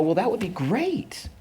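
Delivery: slow, very high-pitched delight; mock delight. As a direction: draw it out high, imitating pleased restraint. Writer reacts to the offer.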